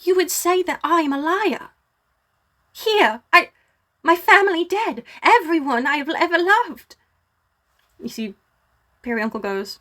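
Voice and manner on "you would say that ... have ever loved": high, wounded princess voice; gasping, distressed princess. Acted. Princess answers being doubted after losing her family, high and distressed. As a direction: protest in hurt disbelief, pitched up high.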